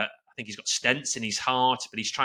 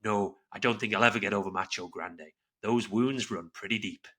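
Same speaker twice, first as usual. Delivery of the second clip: movie witness; 5 semitones lower, 6 dB quieter than usual. Retold or imitated. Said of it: "And he says".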